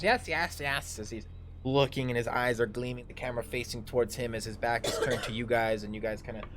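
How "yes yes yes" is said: higher-pitched eager muttering to himself; a touch of greedy glee; gloating drow. Acted. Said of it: "and he goes".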